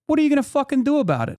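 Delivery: high-pitched, fast confrontational retort; retold angry challenge. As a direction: re-enact squaring up to a heckler. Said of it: "cortisol spike".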